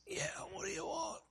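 soft, strangled high rasp; trach voice. Retold or imitated. Dying husband answering his wife, barely able to talk. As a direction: force it out through a blocked throat. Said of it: "had this trach in his throat and couldn't hardly talk".

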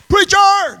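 loud high call across the church. Retold or imitated. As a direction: shout the word out high, like an old lady hollering in church. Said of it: "That's the way she talked".

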